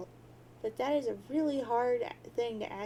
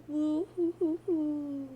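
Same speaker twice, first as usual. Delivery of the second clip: mock sobbing; 3 semitones higher, 4 dB louder, slower than usual. Mock crying, friends gone.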